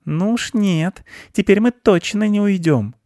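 high, insistent aunt voice; stubborn aunt mouse. A narrator's reading. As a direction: refuse firmly in a high voice. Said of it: "не согласилась его тётя".

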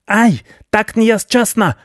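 very high, slow squealing wail; a rat yelping as it escapes. Acted. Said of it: "вопил крыс".

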